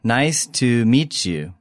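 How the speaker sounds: slow, careful teacher's model; slowly. Shown as a demonstration. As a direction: stretch each word for learners. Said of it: "take a look at the vocabulary".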